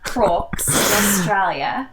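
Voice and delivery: slow, loud Aussie "crocs in Australia"; Australian accent. Shown as a demonstration. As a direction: play up the Aussie drawl. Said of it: "How was that?".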